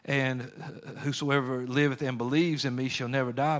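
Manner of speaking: normal talking voice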